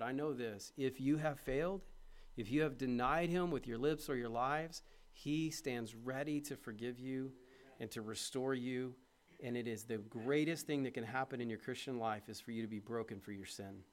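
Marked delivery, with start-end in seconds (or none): none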